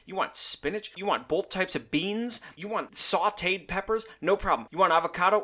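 ordinary talk

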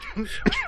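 sudden real coughing fit. Real, not acted. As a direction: choke and cough it out. S1 chokes on a drink.

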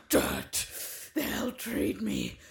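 slow theatrical villain menace; supervillain voice. Acted. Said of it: "can't sustain that voice".

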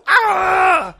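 mock scream. Retold scream in scene.

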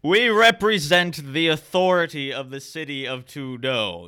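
measured, ringing herald's delivery; commanding knight. Acted. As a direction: declare charges as a pompous authority. Game master voices knight commander.

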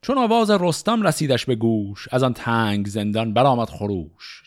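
measured, rhythmic reading of Persian epic poetry; شعرخوانی حماسی; epic verse recitation. Acted. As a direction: recite it like a storyteller of old epics.

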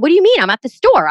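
baffled protest, loud and high; confused disbelief. Retold or imitated. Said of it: "I said".

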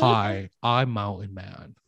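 lightly voiced backwoods woodsman; Mountain Man voice. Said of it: "try to put on my great mountain man affectatious voice".

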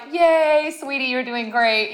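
high, loud, cheerful mock mom; imitating a beaming mother cheering her daughter on; proud mom. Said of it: "she's like".

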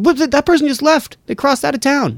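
put-on surprised kid voice. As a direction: chirp it like a shocked kid. Kids noticing someone leave.

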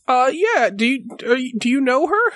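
lightly voiced timid horse; a hint of a nervous young horse; shy talking horse. Horse asks about owner.

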